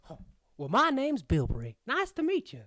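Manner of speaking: swooping prospector twang; crazy old prospector. Acted. Gnome giving his name to the party, old prospector. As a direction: introduce yourself as a folksy old prospector. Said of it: "crazy old prospectors".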